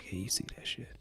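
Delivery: very soft whispered aside; mock whisper. Onlooker nudging a friend about the biceps, whispered. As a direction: hush it to a friend beside you.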